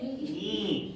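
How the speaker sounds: broad Australian accent on Yeah, rising; an exaggerated Aussie Yeah with a high end. Shown as a demonstration. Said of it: "You're Australian".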